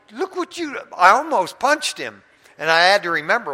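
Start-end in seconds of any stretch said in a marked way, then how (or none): 0.1-0.8 much higher, startled outcry; outraged yelp. Retold or imitated. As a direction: cry out after being bitten. Bitten by the man.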